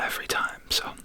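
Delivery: normal talking voice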